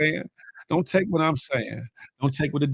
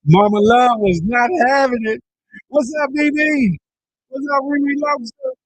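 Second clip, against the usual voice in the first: excited; 11 semitones higher, 6 dB louder than usual. Real, not acted. Laughing recap after clip.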